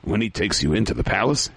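ordinary delivery

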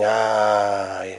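long, low, soft "ah"; quiet sigh of realization; pained sigh. Retold or imitated. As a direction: let out a drawn-out, sorrowful ah. Rabbi sighs at the child's story.

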